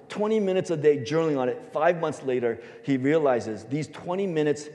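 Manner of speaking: usual speaking voice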